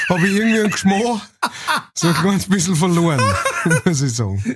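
laughing while talking; words through a laugh. Real, not acted. Laughs at avocado meaning.

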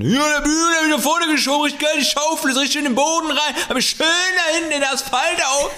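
high, far-off mock bragging voice; excavator driver voice. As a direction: ham up a droning digger driver. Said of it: "So ein Baggerfahrer, der die ganze Zeit".

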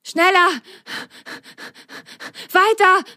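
high, breathless calls; gasping. A narrator's reading. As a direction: pant out the urging. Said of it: "japste Ron".

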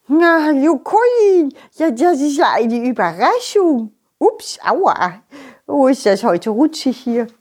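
cheerful snowman voice; friendly snowman greeting. Acted. Snowman speaks.

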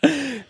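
bright burst of laughter; high laugh. Real, not acted. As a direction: laugh it off, amused. Laughs at own drunkenness.

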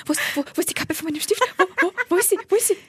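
fast, high, flustered; hurried mock panic; frantic searching. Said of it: "Und er so".

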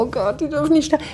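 high, fast mock prayer; a hammed-up plea to God, quick and high. Fan begging God to spare the stars, mock plea.

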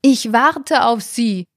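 drawn-out call after a leaving woman; man calling after the woman he is waiting for; calling after her. A narrator's reading. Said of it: "rief ihr der Mann nach".

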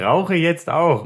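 slow, high mocking echo; mocking imitation. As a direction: parrot him back mockingly. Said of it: "Mein Gott, wie lustig". (spöttisch nachgeäfft)

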